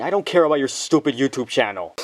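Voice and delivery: normal talking voice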